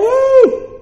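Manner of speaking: crowd cheer. Retold or imitated. They get all excited.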